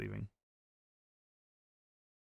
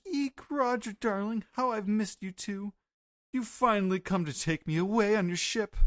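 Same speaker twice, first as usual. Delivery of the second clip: much higher, squealing lovestruck lady; voicing Gloriosa; 9 semitones higher than usual. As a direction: gush over Roger in a high squeal. Gloriosa quoted glomping Roger.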